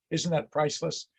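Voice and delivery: normal talking voice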